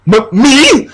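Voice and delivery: high, stammered little me; mock surprised squeak. Heroine shocked at his confession, squeaking. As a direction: gasp the word out high. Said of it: "small gasp".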